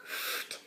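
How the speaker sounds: a soft unvoiced puff, ft; just blow the ft out; breathy ft sound. A narrator's reading. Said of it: "say ft".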